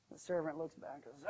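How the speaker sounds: ordinary delivery, nothing marked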